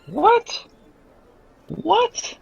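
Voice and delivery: shocked. Real, not acted. GM surprised by dice.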